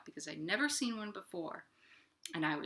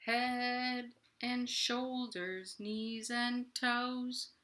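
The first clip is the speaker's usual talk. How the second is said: very slow, soft, stretched singing; slow-motion kids' song; really slow; 7 dB quieter, much slower than usual. Shown as a demonstration. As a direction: drag every word like moving through Jell-O. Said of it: "let's do it really slow, like we're going through Jell-O".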